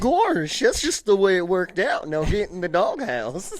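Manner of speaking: high, goofy cartoon-dog voice. Cartoon dog answers why he walks upright, silly high voice. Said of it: "Why was Goofy blessed".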